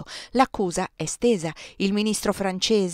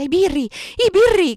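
high, very loud alarm cry; frenzied shout; 12 semitones higher, 10 dB louder than usual. A narrator's reading. Narrator raising the alarm that police have come, frenzied shout. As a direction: shout it like one possessed. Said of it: "gridai da spiritata".

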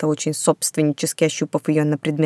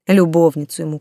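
usual speaking voice

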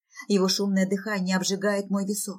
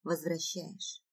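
very soft, slow question; quiet, drawn-out disbelief; stunned hush; 3 semitones lower, 11 dB quieter, slower than usual. Acted. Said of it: "шепчу, проговаривая эти ужасные".

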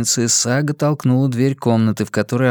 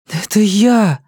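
urgent reassurance; 9 semitones higher, 4 dB louder, slower than usual. Acted. Sister screams, Saga reassures.

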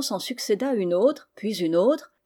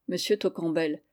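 plain reading voice

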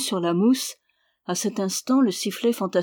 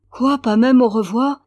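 louder, higher wounded protest; pained; 4 semitones higher, 8 dB louder than usual. A narrator's reading. Aubry begging Jeanine for a farewell, pained. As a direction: cry out in hurt disbelief. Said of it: "fit le jeune homme douloureusement".

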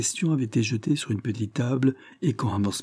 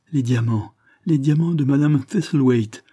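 insistent repeat, rising higher and louder; idiotic insistence; 5 semitones higher, 5 dB louder than usual. A narrator's reading. Innkeeper's wife holding up a necklace, dazed and insistent. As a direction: repeat it with simple-minded insistence. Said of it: "bredouilla l'hôtelière avec une insistance d'idiote".

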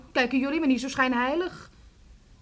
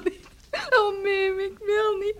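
crying, higher voice, pleading; tearful refusal; 6 semitones higher, 3 dB louder than usual. Acted. Daughter sobbing to her mother that she won't marry. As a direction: break down as you refuse.

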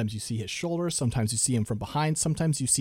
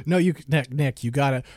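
usual speaking voice

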